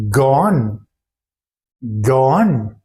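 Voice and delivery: "go on" with a w glide, d dropped; linked go on. Shown as a demonstration. Teacher telling friends what to say instead. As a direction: run "go" straight into "on". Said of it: "but say".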